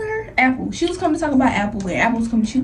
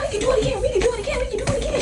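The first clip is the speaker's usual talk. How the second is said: much higher, softer mocking taunt; sing-song dare, pitched way up; taunting girl; 11 semitones higher, 4 dB quieter, much faster than usual. The daughter daring her to fight again, high soft mocking taunt.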